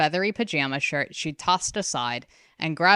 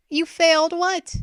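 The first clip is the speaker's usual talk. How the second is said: much higher, louder urgent sister; 13 semitones higher, 6 dB louder than usual. A narrator's reading. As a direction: press her hard. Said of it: "Ren asked urgently".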